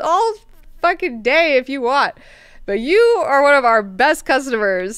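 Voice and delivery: loud, high, incredulous protest; retold buddy-to-buddy remonstration, pushed up loud; mock exasperation. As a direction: plead with a valued customer, exasperated. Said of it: "he's like".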